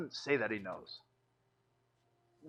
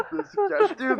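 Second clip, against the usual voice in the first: laughing high and loud; 9 dB louder, slower than usual. Real, not acted. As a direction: say it through laughter. Laughing at a joke.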